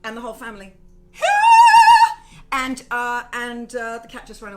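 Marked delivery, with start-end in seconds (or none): 0.8-2.5 loud, high shriek of laughter. Real, not acted. Huge laugh at the news.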